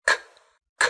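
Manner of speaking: "K" released with a puff of air; aspirated k. Shown as a demonstration. Teacher models the start of common, k with aspiration. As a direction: say "k" as a breath burst without voice. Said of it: "nhớ bật hơi âm".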